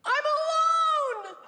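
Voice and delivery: drawn-out, very high mock wail; dad's wailing outburst. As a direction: cry it out like a hysterical father, loud and slow. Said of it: "my dad's reaction was the craziest thing".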